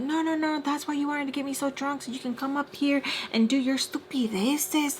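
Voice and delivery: high-pitched mock rant; retold angry accusation. Friend accusing Mister Peach of plotting, high-pitched mock rant. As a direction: imitate her ranting, pitch held up.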